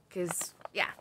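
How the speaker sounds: normal talking voice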